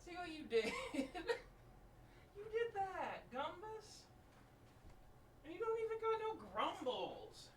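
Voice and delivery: high mock scolding of the dog; playful pet talk. Real, not acted. Teasing the dog who won't growl, high mock scolding.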